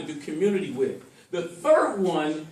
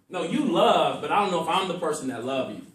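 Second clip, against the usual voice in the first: ordinary talk, nothing marked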